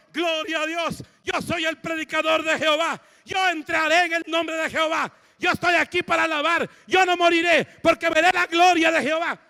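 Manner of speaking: high, loud, rising praise cry; fervent shout. Retold or imitated. Sick patient with his IV, proclaiming he will not die, fervent shout.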